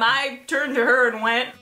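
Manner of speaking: usual speaking voice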